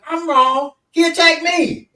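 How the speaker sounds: high, incredulous self-defence; acted-out outraged disbelief; indignant protest. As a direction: protest as if wrongly blamed. Retold indignant protest to sister.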